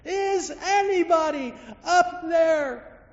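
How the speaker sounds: very high, loud, slow cry; desperate cry for help. Acted. Man about to fall cries out, desperate and loud. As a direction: cry out with everything left. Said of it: "one final cry for help".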